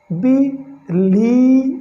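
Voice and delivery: बिलीव stretched very slowly on a long "ee", bileev; drawn-out believe. Shown as a demonstration. Teacher slowly modelling बिलीव for the class. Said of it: "आई ई का बड़ी ई".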